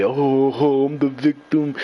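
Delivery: a light, mock self-pitying whine; mock whiny victim. Mocking people who cry when the tables turn, light mock whine. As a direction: play the poor victim, half-mocking. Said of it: "you wanna sit there and cry about it".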